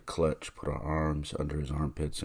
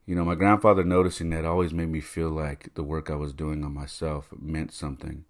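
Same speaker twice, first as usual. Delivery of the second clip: usual speaking voice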